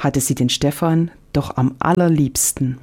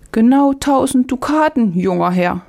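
much higher, put-on old market woman voice; old vegetable woman; 9 semitones higher than usual. Old market woman quoting a price to young Stefan, much higher put-on voice.

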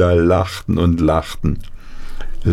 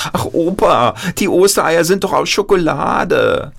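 put-on higher, childlike and breathless with stumbling starts; eager grandchild voice; 10 semitones higher than usual. Grandchild pleading with Opa Paul, eager childlike voice. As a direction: play an excited little grandchild asking.

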